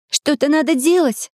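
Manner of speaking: urgent high exclamation; exclaiming. Acted. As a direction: blurt it out in alarm. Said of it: "воскликнула она".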